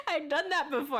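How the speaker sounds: laughing through it; words broken by laughter. Real, not acted. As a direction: giggle while admitting it. Laughing confession to chat.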